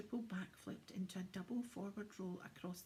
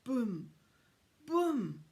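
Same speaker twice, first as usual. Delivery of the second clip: loud booming footsteps; loud Boom, like giant footsteps; booming shout; 4 semitones higher, 13 dB louder than usual. Acted. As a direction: bellow each boom, big and heavy. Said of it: "cried out all of a sudden".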